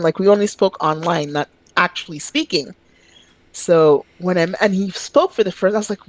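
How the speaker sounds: ordinary talk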